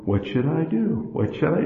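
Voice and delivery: soft, hushed, to himself; quiet inner questioning. Retold or imitated. Preacher voicing the mind's soft question. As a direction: murmur the doubt like a mind thinking aloud. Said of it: "your mind is saying".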